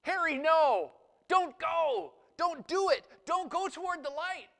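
high, loud panicked cry of "don't go"; frantic fly voice. Retold or imitated. Pastor acting the cartoon fly begging his friend away from the zapper. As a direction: yell after your friend to stop him. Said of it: "his friend, he says".

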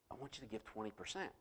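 hushed and low, barely above a murmur; soft, low inner voice speaking his name; quiet voice of God. Retold or imitated. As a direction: let it come softly, like an inner voice. God repeats to his spirit.

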